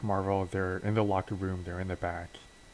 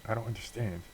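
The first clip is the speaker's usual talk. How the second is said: confused; 3 semitones higher, 7 dB quieter than usual. Acted. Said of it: "June Allison is even more confused now".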